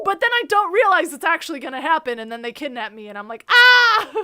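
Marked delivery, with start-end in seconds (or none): 3.5-4.1 short high mock scream of fright. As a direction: yelp it like you've just been grabbed. Retold being kidnapped, screams.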